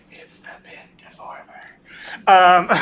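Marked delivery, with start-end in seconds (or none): 0.1-1.6 mock-secretive whisper, all breath; joking whisper. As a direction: whisper it as if slipping someone the answer. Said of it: "that would be weird if I whisper it".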